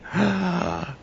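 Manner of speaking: mock dying gasp; sharp, airy gasp put on for effect; startled gasp. Showing listeners how not to react to death, mock gasp. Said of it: "pero no estás así".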